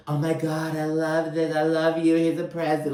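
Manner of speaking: high, monotone mock gush; Lisa impression. Host mimics Lisa handing over a present, high mock gushing. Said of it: "that's Lisa".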